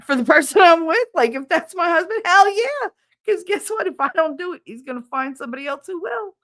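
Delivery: high and loud, defiant and insistent; heated retort. Retold or imitated. Retelling an argument with grandma, a high loud retort. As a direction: fire it back at her, high and worked up. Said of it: "I was like".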